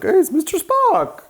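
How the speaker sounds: very high, loud delighted squeal; excited kid. Retold or imitated. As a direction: exclaim like a thrilled young child. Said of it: "and I was like".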